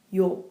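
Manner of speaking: Yo said with a round open o; pron yo. Shown as a demonstration. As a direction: clear Korean yo vowel. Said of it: "Compare yo and ya".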